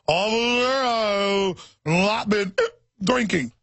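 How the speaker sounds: put-on slow, high-pitched, slurred drunk plea; mock drunk. Drunk driver pleading with the cop, mock slurred. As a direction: play a sloppy drunk pleading his innocence. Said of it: "you're slurring your words".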